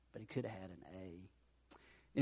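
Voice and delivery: dropped low and soft, slowed; quiet punchline. Real, not acted. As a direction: let it land softly and slowly. Quiet punchline after story.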